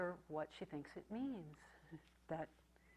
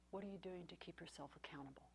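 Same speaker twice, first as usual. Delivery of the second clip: a whispered retelling of their question; the group's question, whispered; 14 dB quieter, faster than usual. Retold or imitated. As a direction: hush it right down to a whisper. Retold group members questioning her.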